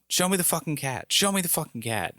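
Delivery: high-pitched mock angry demand; imitated drunk ranting. Drunk neighbor demanding the cat, mock ranting. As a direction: imitate him going off, without added volume. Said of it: "he's just going off and going off".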